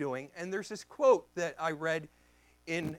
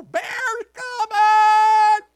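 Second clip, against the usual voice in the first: high, drawn-out full shout; yelled warning; 15 dB louder, much slower than usual. Retold or imitated. Man warning singing campers of a bear, yelling. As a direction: holler a long alarm to distant people. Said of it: "Do I yell".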